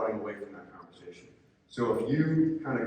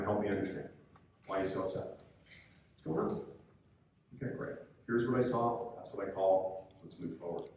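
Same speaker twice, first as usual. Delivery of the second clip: soft, calm, reassuring, softer than the lines round it; nice calm tone; 4 semitones lower, 6 dB quieter than usual. Shown as a demonstration. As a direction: talk an angry coach down gently. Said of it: "this nice calm tone of voice".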